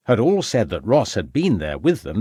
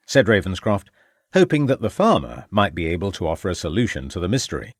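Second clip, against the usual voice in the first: ordinary delivery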